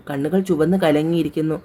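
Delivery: ordinary delivery, nothing marked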